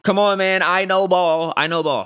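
loud, high burst of bragging; vindicated shout, easing slightly on the repeat; triumphant boast. Real, not acted. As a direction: crow it loudly, proud of the call. Crows over his correct pick.

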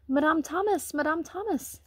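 calling out high and loud; boy running home calling the housekeeper, loud and excited; excited shout. Acted. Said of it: "he shouted".

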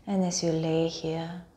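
unhurried meditation voice, phrase by phrase; guided meditation. Acted. Teacher guiding listeners lying on mats through a body scan, guided meditation.

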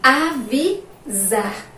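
sounded out syllable by syllable; slow dictation. Shown as a demonstration. Teacher dictating a word to the class, slow syllables. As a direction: draw out "avisar" in separated chunks.

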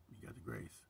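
usual speaking voice

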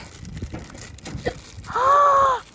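high excited gasp. Real, not acted. Rare figure revealed.

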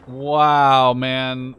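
loud frustrated wow after dying; exasperated gamer yelp; exasperated outburst. Real, not acted. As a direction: blurt it out, fed up. Dies in game, frustrated.

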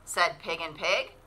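put-on lively storybook narration for kids; animated picture-book read-aloud; reading to children. A narrator's reading. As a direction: read it like story time at the library.

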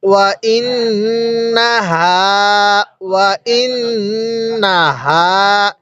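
ওয়া ইন্নাহা slowly, the doubled ন hummed through the nose; with ghunnah. Shown as a demonstration. Teacher demonstrating ghunnah to students, slow nasal recitation. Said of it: "গুন্নাহ করে পড়তে হবে".

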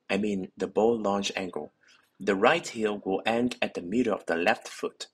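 ordinary delivery

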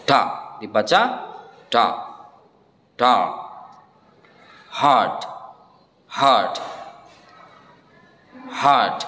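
dark and hard with no r. Shown as a demonstration. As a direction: say hard as hahd, long ah. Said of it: "R-nya dihilangkan menjadi hard".

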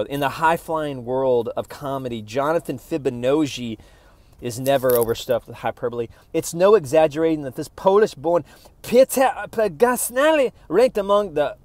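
pompous announcer read of a review; smug reading. Acted. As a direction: read it like a self-important press blurb. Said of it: "how smug this sounds".

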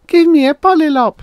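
put-on very high, loud child voice; high-pitched kid demand; demanding little girl. A narrator's reading. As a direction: insist like a small girl.

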